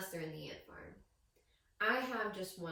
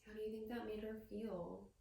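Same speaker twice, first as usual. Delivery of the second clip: much softer, gentle ask; soft question; 8 dB quieter than usual. Real, not acted. Reader softly asking the children about her feelings. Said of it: "question to children".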